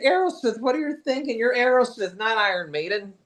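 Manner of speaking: high-pitched incredulous scolding; mock exasperation. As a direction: scold the band in a high, disbelieving voice. Voicing exasperated rebuke to band.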